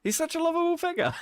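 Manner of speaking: mock praise through high laughter; can barely speak for laughing. Real, not acted. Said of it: "you can't say it without laughing".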